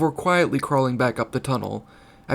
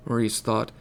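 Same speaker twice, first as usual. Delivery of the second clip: usual speaking voice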